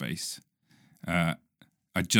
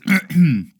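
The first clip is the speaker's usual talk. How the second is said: sharp loud throat clear; 8 semitones higher, 11 dB louder than usual. Real, not acted. Throat clears again mid-story.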